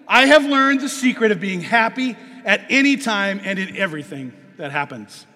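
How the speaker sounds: loud, ringing sermon read; preaching at full force. Acted. Preacher proclaiming Paul's verse loudly to the church. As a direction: proclaim it to the congregation.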